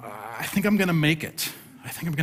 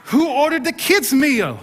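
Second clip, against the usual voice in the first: loud, much higher booming call; big booming voice; 13 semitones higher, 4 dB louder than usual. Retold or imitated. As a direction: boom it across the restaurant with a laugh. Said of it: "big booming voice and an accent".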